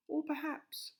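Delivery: high, loud wife voice; squeaky high wife. A narrator's reading.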